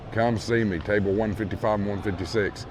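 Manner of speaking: ordinary talk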